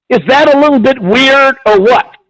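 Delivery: usual speaking voice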